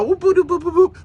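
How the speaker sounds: playful high boops copying a ringtone; phone alert boops. Retold or imitated. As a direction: mimic a chirping phone alert, boop by boop. Imitating phone alert sounds.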